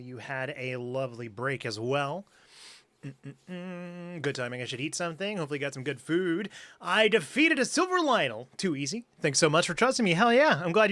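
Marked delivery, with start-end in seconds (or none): none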